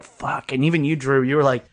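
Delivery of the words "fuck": breathy whispered curse under the breath; whispered fuck. Retold or imitated. Reading the article, a whispered curse. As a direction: whisper it in dismay. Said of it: "I was just like".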